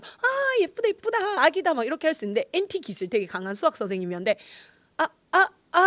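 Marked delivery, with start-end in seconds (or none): 0.0-1.7 put-on high-pitched cooing over a baby; acted burst of adoring praise; gushing admiration. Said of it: "공감을 잘 형성하는 엔에프 기질들은". 5.0-5.8 high, halting, stuck syllables; short awkward stammered gasps; lost for words. Retold or imitated. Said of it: "말이 안 나오더래요".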